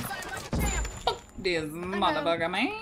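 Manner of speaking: slow, laughing swear; laughing curse. Real, not acted.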